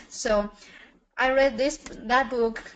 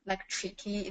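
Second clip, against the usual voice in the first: ordinary talk, nothing marked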